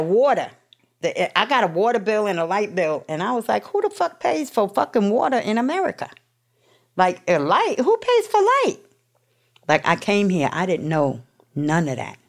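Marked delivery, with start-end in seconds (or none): 3.7-8.8 high, disbelieving rant; mock outrage building to a high squeak; incredulous outrage. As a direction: squeal it like it's absurd. Retold disbelief at utility bills.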